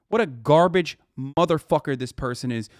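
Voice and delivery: ordinary talk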